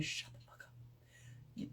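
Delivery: slow hushed whisper; a slow whispered aside. Real, not acted. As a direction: breathe it out slowly like a secret. Blunt advice to women.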